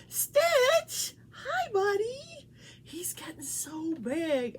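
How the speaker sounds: high cooing pet voice. Real, not acted. Talking to her pet.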